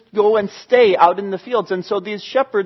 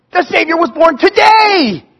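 higher, louder joyful cry; joyful exclaim; 7 semitones higher, 4 dB louder than usual. Acted. Shepherds celebrating the news, joyful exclaim. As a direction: burst out with joy. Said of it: "the shepherds are going".

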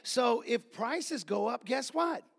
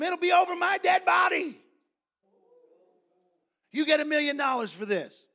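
loud, high refusal, easing toward the end; retold defiant shout; angry defiance; 5 semitones higher, 8 dB louder than usual. Doctor Schuler refusing the landowner, angry and defiant. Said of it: "and he got mad".

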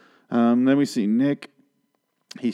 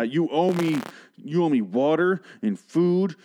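higher, slow mock outraged demand; mock outrage; 7 semitones higher, slower than usual. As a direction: ham up an entitled guest.

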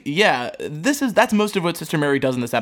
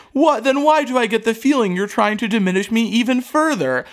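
high, indignant yelp of hurt; a hint of a cardinal about to cry; mock wounded outrage; 7 semitones higher than usual. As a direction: play the cardinal near tears, squeaking. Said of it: "looks like he's about to cry".

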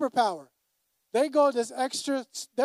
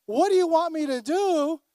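higher, louder incredulous repeat; exasperated echo; 8 semitones higher, 6 dB louder than usual. Retold or imitated. Pastor echoing his wife's reply, incredulous and louder. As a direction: throw the question back in disbelief.

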